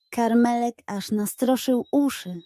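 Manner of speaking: ordinary delivery, nothing marked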